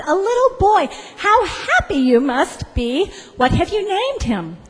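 high, lilting congratulations; effusive official in a high, swooping pitch; delighted gushing. Acted. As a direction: coo and gush over someone's new baby. Gushing civil servant congratulates new father.

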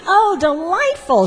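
high, swooping congratulation; high-pitched, effusive delight; delighted gushing. Acted. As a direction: gush over the happy news. Gushing civil servant congratulates new father.

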